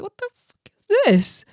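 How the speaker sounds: high, incredulous exclamation; stunned. Retold or imitated. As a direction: react like you can't believe what you're seeing. Stunned at the applauding room.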